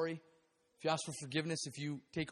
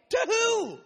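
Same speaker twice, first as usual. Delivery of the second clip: high, very loud cry of protest; indignant outburst; 14 semitones higher, 11 dB louder than usual. Retold or imitated. Son protesting that dad gave it away, indignant shout. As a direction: burst out loud and high in mock outrage. Retold outburst at dad's giving.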